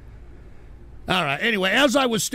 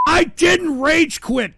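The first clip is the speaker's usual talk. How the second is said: much higher, louder, slow shouted denial; exasperated yell; 9 semitones higher, 7 dB louder, slower than usual. Real, not acted. Shouting at chat over the TTS that he didn't rage quit. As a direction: yell it over the noise, fed up.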